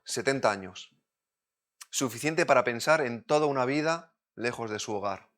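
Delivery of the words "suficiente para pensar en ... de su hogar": ordinary talk, nothing marked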